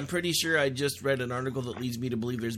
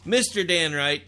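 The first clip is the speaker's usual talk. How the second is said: radio host intro; 4 semitones higher, 8 dB louder than usual. Acted. Radio show opening.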